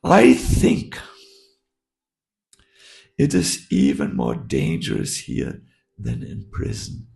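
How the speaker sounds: put-on a loose heavy Russian accent; lightly put-on Russian accent. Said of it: "he said in a heavy Russian accent".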